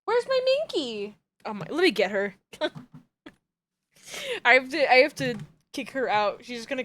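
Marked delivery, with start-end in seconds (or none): 0.0-1.2 high cooing pet voice; high sing-out to a hiding cat; calling a cat. Real, not acted.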